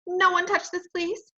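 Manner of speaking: real loud. Acted. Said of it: "he said real loud".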